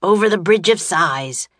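loud barked command; grouchy shout. A narrator's reading. Grouchy guard driving prisoners to the bridge, shouted. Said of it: "shouted the grouchy guard".

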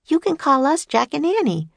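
put-on high, eager little girl's voice; bright young girl. A narrator's reading. Annie introducing herself and Jack to famous inventors, eager girl voice. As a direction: chirp it like an excited young girl.

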